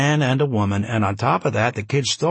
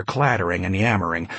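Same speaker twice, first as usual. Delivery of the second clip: plain reading voice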